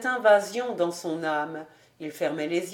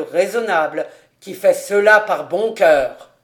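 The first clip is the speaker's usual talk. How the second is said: put-on louder, solemn old tax collector; grave tone; 8 dB louder than usual. A narrator's reading. Collector telling the girl who pays, solemn and loud. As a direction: announce it with grave weight. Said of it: "il lui dit d'un ton grave".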